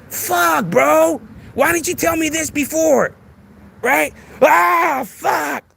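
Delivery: mock panic. Mock panic over the kingpin.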